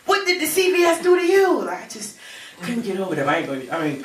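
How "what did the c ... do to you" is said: high, indignant questioning; exasperated disbelief. Retold or imitated. Onlooker to rioters burning the store, indignant and incredulous. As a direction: climb high and plead for sense. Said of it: "I'm like".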